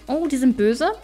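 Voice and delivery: usual speaking voice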